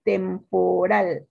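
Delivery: "Temporal" stretched into tem, po, RAL; syllable-by-syllable stress demo. Shown as a demonstration. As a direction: slowly sound out "Temporal" so RAL stands out. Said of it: "Cuál es su sílaba tónica".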